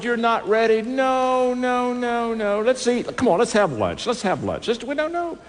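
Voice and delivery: high, playful put-off; teasing refusal. Retold or imitated. Preacher telling the CEO he is not ready, high and playful. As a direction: tease him and wave it away.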